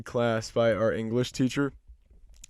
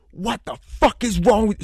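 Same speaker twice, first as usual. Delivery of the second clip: raised, louder mock scream; retold scream; 8 semitones higher, 5 dB louder than usual. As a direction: imitate her screaming in outrage. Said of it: "starts screaming".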